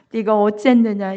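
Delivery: ordinary delivery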